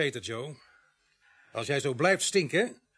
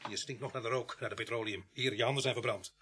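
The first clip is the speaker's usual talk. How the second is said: soft, lowered, pressing voice; low-key menace; 3 semitones lower, 8 dB quieter than usual. Acted. Policeman putting evidence to arson suspect, quietly accusing. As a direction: drop the voice and press him.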